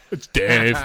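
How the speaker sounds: loud put-on announcement; mock dismissive introduction. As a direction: blurt the name as a mocking impression. Mocking wife's dismissive introduction.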